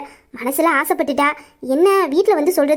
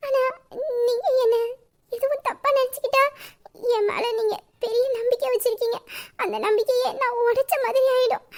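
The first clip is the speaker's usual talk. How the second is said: tearful apology; 8 semitones higher than usual. Acted. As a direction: beg mother's forgiveness, close to crying. Daughter tearfully apologizes to mother. (அழுகையுடன் மன்னிப்பு கேட்டல்)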